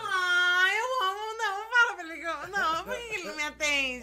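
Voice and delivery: playful fake weeping plea; mock crying. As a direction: whine like a jilted lover, half joking. Mock lovesick whining to absent guy.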